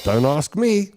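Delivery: usual speaking voice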